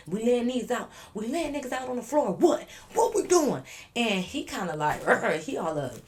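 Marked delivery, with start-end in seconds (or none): 0.1-3.6 building higher and louder, egging on; mock aggressive challenge. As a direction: imitate a hothead spoiling for a fight. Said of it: "he a little aggressive".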